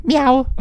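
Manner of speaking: high playful cat meow; a squeaky little meow; mock meow. Greeting cat Lily.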